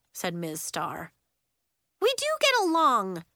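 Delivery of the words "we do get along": protesting. Acted. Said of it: "Nori protested".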